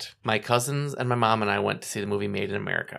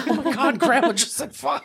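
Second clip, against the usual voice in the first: high, loud, laughing disbelief; retold shock; 6 dB louder than usual. Grandkids reacting to Grandma swearing, shocked and laughing. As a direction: imitate scandalized, delighted grandkids.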